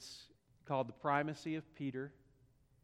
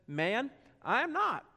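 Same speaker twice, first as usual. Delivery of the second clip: acted denial, higher and louder; emphatic denial; 8 semitones higher, 6 dB louder than usual. Peter answering someone who saw him, emphatic acted denial. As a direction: deny it hard, pitch jumping up. Peter denies knowing Jesus.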